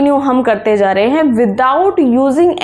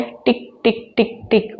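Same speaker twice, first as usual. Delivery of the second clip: quiet, even "टिक टिक टिक टिक" like a clock; hushed clock tick imitation; soft clock ticking; 2 semitones lower, 6 dB quieter, slower than usual. Shown as a demonstration. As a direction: tick softly like a wall clock. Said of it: "घड़ी क्या करती है?".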